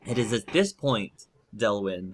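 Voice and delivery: ordinary talk, nothing marked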